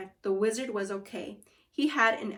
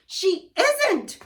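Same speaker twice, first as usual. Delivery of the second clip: loud; 9 semitones higher, 8 dB louder than usual. Retold or imitated. Father retells his denial.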